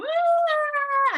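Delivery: loud, high whiny wail; imitating a child's whining cry at the sink; imitated whine. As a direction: wail it like a frustrated little girl at the tap. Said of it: "she would go".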